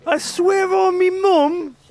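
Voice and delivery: very high, slow, loud cockney oath; mock British accent. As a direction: stretch the oath like a stage Brit. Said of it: "that's my impression of, like, a Brit".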